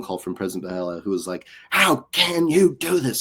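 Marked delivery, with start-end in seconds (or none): 1.7-3.2 retold screaming. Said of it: "screaming at me".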